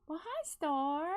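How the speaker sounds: high, loud, slow sing-song hello; sweet greeting. Real, not acted. Greeting Star mid-story, loud high baby talk. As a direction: coo at the pet in a high voice.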